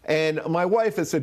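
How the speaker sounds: usual speaking voice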